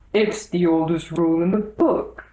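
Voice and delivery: lightly voiced pompous king; a touch of royal bluster. The king speaking.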